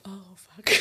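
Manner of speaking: hushed, dismayed swear; retold quiet dread; sinking realization. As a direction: let it out under your breath as it dawns on you. Retold dread on seeing texts.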